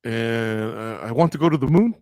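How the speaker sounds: imitating fighter's wish list. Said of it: "Oh, he goes".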